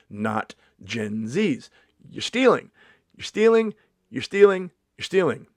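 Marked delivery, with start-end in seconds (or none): 2.1-5.5 high, loud repeated accusation; loud, high mock-indignant accusation; mock accusing. Real, not acted. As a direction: call them out, indignant. Accusing Gen Z of theft.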